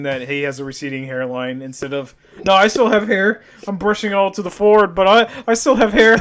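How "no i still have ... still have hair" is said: mocking voice. Mocking balding guy in denial.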